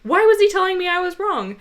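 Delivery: loud, high indignant protest; indignant and insistent, pushed loud; retold angry outburst. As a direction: replay being wronged, voice rising. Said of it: "I was just getting so angry".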